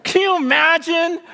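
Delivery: high, amazed sermon cry; impassioned preaching. Real, not acted. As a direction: burst out in marveling fervor. Preaching at full force.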